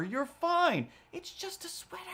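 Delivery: high, hushed little self-reassurance; high mock reassurance. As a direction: squeak it out to calm yourself. Reassuring himself, quoted.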